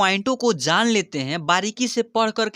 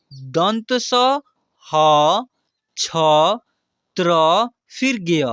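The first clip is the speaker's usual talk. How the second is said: each letter said loud and slow with pauses; letter-by-letter recitation; 3 semitones lower, 4 dB louder, much slower than usual. Shown as a demonstration. Teacher reciting consonants slowly and loudly for students. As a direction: call out each letter clearly for the class to repeat. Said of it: "जैसे उच्चारण कर रहा हूँ मैं".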